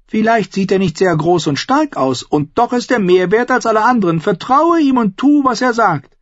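high, loud, ranting Lysa; bitter aunt. A narrator's reading. Aunt Lysa rants to Sansa about Tyrion, high and heated. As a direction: rail against the dwarf, spiteful.

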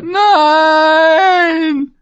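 very high, long wailing scream. A narrator's reading. Prisoner at the slammed door, screaming and wailing. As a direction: stretch it into a desperate howl. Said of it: "schrie er".

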